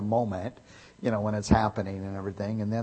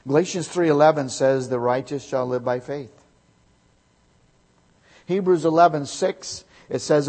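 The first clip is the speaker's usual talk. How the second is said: loud, raised preacher's voice quoting verses; fiery preaching; 4 semitones higher, 7 dB louder than usual. Acted. Preacher reading verses to the congregation, loud and raised. Preaching scripture at full force.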